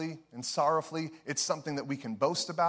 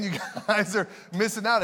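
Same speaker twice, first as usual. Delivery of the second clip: laughing; 5 semitones higher, 3 dB louder than usual. Shown as a demonstration. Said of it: "repeated laughing".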